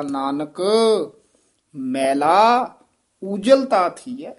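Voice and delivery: ਮੈਲਾ set apart by a short pause on each side; ਮੈਲਾ ਸ਼ਬਦ ਦੇ ਅੱਗੇ ਪਿੱਛੇ ਠਹਿਰਾਅ; pause before and after ਮੈਲਾ. Shown as a demonstration. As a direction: pause before ਮੈਲਾ, say ਮੈਲਾ alone, pause again before ਉਜਲ. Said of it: "ਅੱਗੇ ਵੀ ਠਮਕ ਲਾਉਣੀ ਹੈ, ਮਗਰੋਂ ਵੀ ਠਮਕ ਲਾਉਣੀ ਹੈ".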